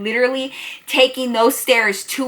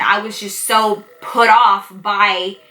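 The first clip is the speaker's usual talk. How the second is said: ordinary talk, nothing marked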